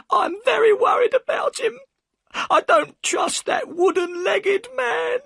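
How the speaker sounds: high, loud crying plea; bursting into tears. A narrator's reading. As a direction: sob it out to the detective. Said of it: "and burst into tears".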